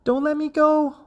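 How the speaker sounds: very high, anxious mother frog; worried warning. A narrator's reading. Mother frog afraid of falling, high warning. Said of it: "warned mother".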